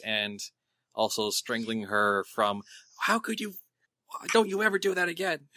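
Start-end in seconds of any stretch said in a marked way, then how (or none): 3.0-5.5 high-pitched, upset telling-off; acted distraught reprimand; frantic scolding. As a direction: scold in a raised, worried voice. Character scolds Flotsam while hugging her.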